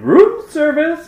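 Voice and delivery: put-on very high, slow German-accented room service; high slow German caller voice; German-sounding voice. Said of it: "a very German-sounding voice saying".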